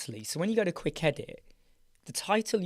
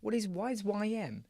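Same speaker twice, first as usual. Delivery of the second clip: ordinary talk, nothing marked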